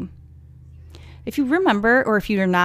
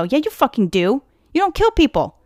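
rising to a high, loud scold; indignant outburst; 6 semitones higher, 4 dB louder than usual. Real, not acted. Host scolding the friend, indignant. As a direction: tell him off, voice jumping up.

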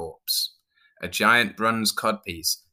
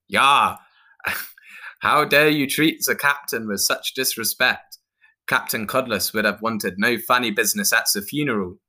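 put-on loud, raised-pitch accented Viking; indignant Viking; 4 semitones higher, 4 dB louder than usual. Viking rebukes clown for disrespecting captain, loud and indignant. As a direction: boom out outrage as a Viking.

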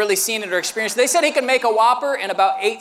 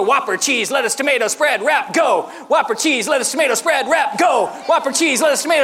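quick rhythmic chant, higher pitch; on beat; 3 semitones higher than usual. Retold or imitated. Brother flinging Whoppers together, quick chant on beat. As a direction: rattle the words off to a driving beat. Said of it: "make the Whoppers on beat".

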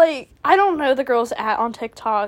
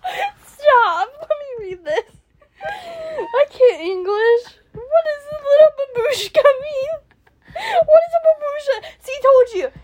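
high-pitched laughing through the words; can't stop giggling while reading; real helpless laughter; 11 semitones higher than usual. Real, not acted.

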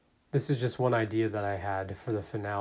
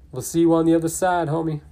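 higher, louder and fast, a cocky goodbye; hyped Roman farewell; 5 semitones higher, 7 dB louder, faster than usual. Acted. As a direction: rattle it off like Roman. Roman's farewell line, voiced.

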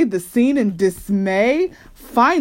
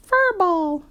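high, piping little call; mock child's shout; 11 semitones higher than usual. A narrator's reading. Honey gleefully echoing the insult, small high shout. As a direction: pipe it up high without much volume. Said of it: "shouted Honey, laughing".

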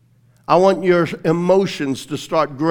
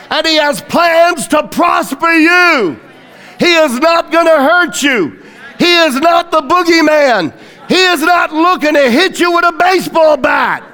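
fiery pulpit delivery, every line hammered home; preaching at full force; 10 semitones higher, 5 dB louder than usual. Acted. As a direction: preach it out to the back row. Preaching to congregation, loud.

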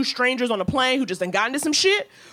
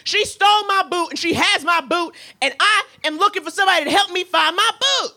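higher, aggrieved pleading; whiny complaint; 6 semitones higher than usual. Retold or imitated. Older man complaining to the flight attendant, higher and aggrieved. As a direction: wail about the stolen boot. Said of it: "he's like".

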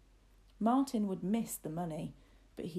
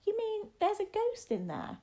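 put-on very high, anxious child voice; nervous boy; 4 dB louder than usual. A narrator's reading. Boy hears the tower is haunted, high and nervous. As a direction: ask it high, as a frightened little master. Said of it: "said William nervously".

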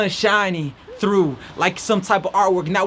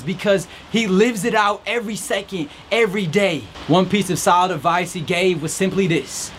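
usual speaking voice

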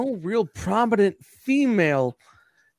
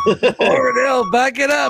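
slow, loud hype call; hype shout; 5 semitones higher, 4 dB louder, slower than usual. Acted. Hyping up, shouting.

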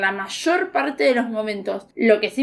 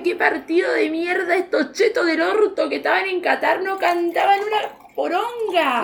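higher, laughing through the rant; 5 semitones higher than usual. Real, not acted. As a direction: rant at the fans while cracking up. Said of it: "laugh heard". (despotricando entre risas, más aguda)

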